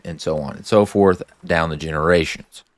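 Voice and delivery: normal talking voice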